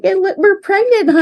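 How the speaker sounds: retold delight at the test result; excited announcement. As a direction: burst out with the happy surprise. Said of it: "I'm like".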